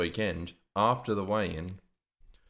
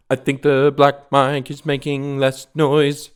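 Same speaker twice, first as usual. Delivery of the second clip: loud mic test; 5 semitones higher, 10 dB louder than usual. Real, not acted. Testing microphone distance.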